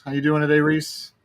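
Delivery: ordinary delivery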